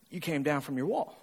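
very soft, low private verdict; pastor's inner voice. Retold or imitated. Pastor silently answering a struggling churchgoer, soft and low. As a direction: say it under your breath, as if only thinking it. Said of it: "in my head, I'm going".

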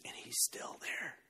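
whispered punchline, slow; hushed reveal. A narrator's reading. Preacher telling the congregation Jesus is still there, whispered. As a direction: lean in and whisper the surprise.

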